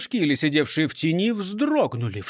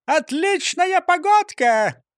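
high, slow chirping parrot; cartoon bird voice; 11 semitones higher, 4 dB louder, slower than usual. A narrator's reading. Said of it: "прощебетала Клара в клетке".

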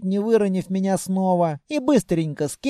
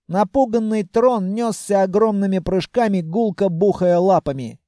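ordinary delivery, nothing marked

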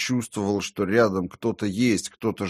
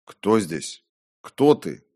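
frightened demand; 4 dB louder, slower than usual. A narrator's reading. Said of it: "Я похолодел от ужаса".

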